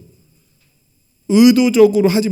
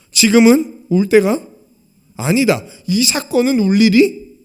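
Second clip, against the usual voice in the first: slow and louder, word by word; firm declaration; 5 semitones higher, 6 dB louder than usual. Acted. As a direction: declare it deliberately. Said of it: "이 얘기하시는 거예요". (천천히 힘있게 선언하듯)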